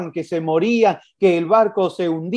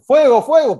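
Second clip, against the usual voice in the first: high, loud alarm cry of fire; shouting fire; 7 semitones higher, 7 dB louder than usual. Retold or imitated. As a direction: yell it like the house is burning. Imagined alarm call of fire.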